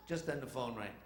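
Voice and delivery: usual speaking voice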